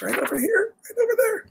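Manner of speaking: teasing. Real, not acted. Teasing kids about the next page.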